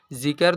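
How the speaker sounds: "जिक्र" split as zi-kar; wrong pronunciation. Shown as a demonstration. Teacher warns against a misreading, saying "जिक्र" as zi-kar. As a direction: add a vowel between क and र in "जिक्र". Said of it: "तो नहीं पढ़ेंगे".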